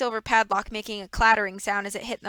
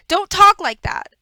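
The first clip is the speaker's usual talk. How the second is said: higher, much louder desperate cry; anguished shout; 10 semitones higher, 12 dB louder, slower than usual. Acted. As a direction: scream at him to stop. Violet cries out at Stefano.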